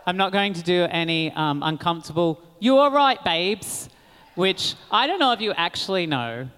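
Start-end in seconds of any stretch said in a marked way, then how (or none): 2.6-3.9 a light British "you all right, babes" in a higher, louder voice; British slang accent. Retold or imitated. [name]'s British slang, lightly done in a British accent. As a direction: toss it off like a Londoner. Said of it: "British isms".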